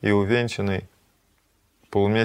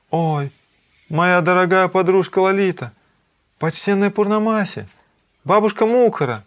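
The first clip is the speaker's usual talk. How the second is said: high, loud cries of astonished recognition; startled cry; 10 semitones higher, 7 dB louder than usual. A narrator's reading. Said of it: "вскрикнула от неожиданности".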